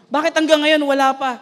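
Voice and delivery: retold frustrated prayer; pleading complaint. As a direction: demand to know why it's late. Retells his complaint to God.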